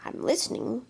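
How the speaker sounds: ordinary talk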